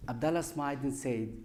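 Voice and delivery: ordinary talk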